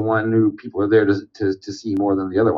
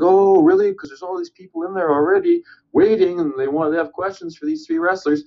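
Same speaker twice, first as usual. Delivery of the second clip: playing it off. Organizer plays it off to the angry storyteller, mock innocent. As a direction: feign surprise in a much higher voice. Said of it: "tried to play it off like".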